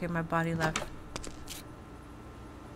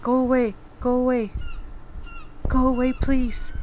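louder, urgent begging; frightened pleading; 3 semitones higher, 5 dB louder than usual. Real, not acted. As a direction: beg it to leave. Begging the monster to leave.

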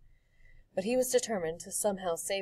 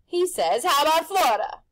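loud, high showman pitch; pushy grinning tempter; Rictus's brash voice; 9 semitones higher, 13 dB louder than usual. A narrator's reading. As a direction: sell it like a carnival barker. Rictus relaying phone call.

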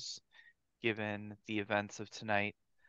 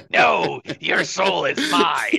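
loud, laughing evil boast; laughing villain shout; 8 semitones higher, 17 dB louder, slower than usual. Acted. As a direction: shout it out like a gloating devil. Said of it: "That's not how the line goes".

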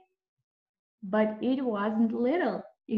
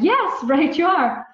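eager animal voices; 7 semitones higher, 10 dB louder than usual. A narrator's reading. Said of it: "They all said".

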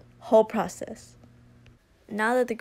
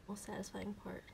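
breathy near-silent whisper, wet mouth sounds; soft whisper; 17 dB quieter than usual. Shown as a demonstration. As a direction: whisper it right into the mic. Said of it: "Did that sound gross?".

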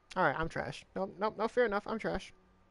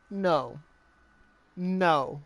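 ordinary talk

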